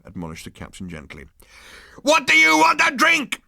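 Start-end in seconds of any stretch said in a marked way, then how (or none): 2.0-3.4 much higher and louder scream; screaming lackey. A narrator's reading. Number two screams the drink order at the prisoners. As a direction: scream the offer like a threat. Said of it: "Number two screamed".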